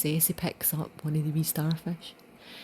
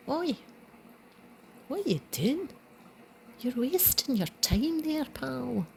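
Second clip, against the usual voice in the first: put-on higher gruff scolding old man voice; lightly voiced old man, a touch higher; mock old man; 8 semitones higher than usual. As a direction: call out to the boy like a grumpy old man.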